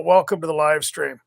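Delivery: normal talking voice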